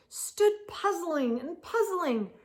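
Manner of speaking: ordinary delivery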